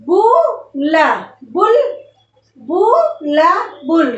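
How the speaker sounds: बुला, बुल split and said slowly; sounding out in parts. Shown as a demonstration. Teacher splits बुलबुल into parts for pupils. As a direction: break बुलबुल into slow parts. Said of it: "तो शब्द हमारा क्या बना?".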